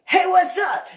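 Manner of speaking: loud, very high shout; mock sneak-up yell; acted boisterous greeting. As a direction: pounce with a booming hello. Acted startling greeting from behind.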